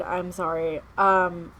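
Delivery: usual speaking voice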